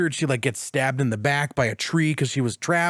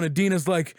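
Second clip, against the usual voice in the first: ordinary delivery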